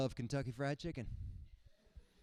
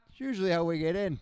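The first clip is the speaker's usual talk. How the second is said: ordinary talk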